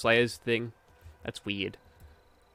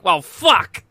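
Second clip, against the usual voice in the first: mock outrage; 3 semitones higher, 6 dB louder than usual. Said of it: "they'll be like".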